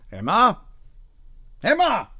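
high, loud call for his wife. A narrator's reading. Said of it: "Charles called".

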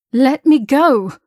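slow, loud, high command; demanding. Acted. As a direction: demand it, holding steady. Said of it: "she demanded".

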